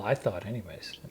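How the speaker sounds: soft unvoiced afterthought; a whispered self-deprecating aside to his partner. Real, not acted. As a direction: whisper it under your breath.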